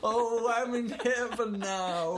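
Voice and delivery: high, slow blissful mock voice; blissful Pope. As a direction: sigh like a happy old man. Imitating the Pope.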